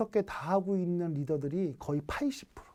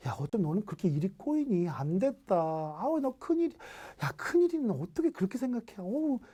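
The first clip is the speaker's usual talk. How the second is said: exaggerated sympathy that sounds like blame; pitying tone; 3 semitones higher than usual. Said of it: "막 동정하는데". (혀를 차며 높은 목소리로 과장되게 동정하는 말투)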